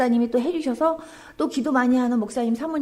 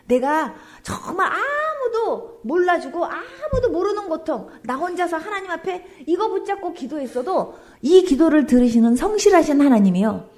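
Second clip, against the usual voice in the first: impassioned preaching; 5 semitones higher, 3 dB louder than usual. Acted. Preacher stirring the church, near tears. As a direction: plead with the congregation, choked up.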